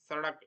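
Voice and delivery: सड़क with a dental s at the start. Shown as a demonstration. Teacher giving an example word, dental स in सड़क. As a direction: begin सड़क with the tongue at the teeth. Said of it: "इसका उच्चारण इस प्रकार होगा".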